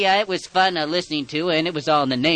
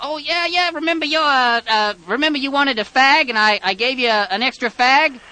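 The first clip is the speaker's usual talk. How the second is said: high, loud overeager caller voice; excited prank caller; 11 semitones higher, 6 dB louder than usual. Acted. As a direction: insist you're old friends, loudly. Caller keeps up the persona.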